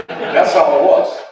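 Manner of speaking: slow, loud laughing words; laughing at the coach's quip. Real, not acted. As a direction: laugh through the punchline.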